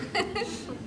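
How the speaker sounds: a quick breathy laugh at the mishap; short laugh. Real, not acted. Speaker laughing as someone bumps the lights.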